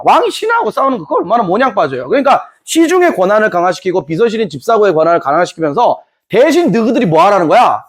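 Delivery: ordinary talk, nothing marked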